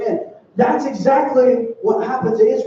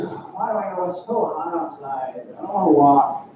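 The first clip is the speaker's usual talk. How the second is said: put-on low, grumbling kid's complaint; lightly whiny, put-upon grumble; mock whining; 6 semitones lower than usual. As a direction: imitate a sulky child whining, pitched low. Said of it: "David didn't whine to his parents".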